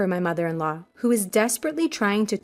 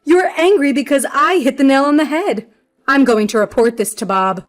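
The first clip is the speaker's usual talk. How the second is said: high, loud triumphant gloat; gleeful, raised-voice taunt; laughing, gloating; 4 semitones higher, 6 dB louder than usual. Acted. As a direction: crow loudly at being proved right. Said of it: "laughing and saying".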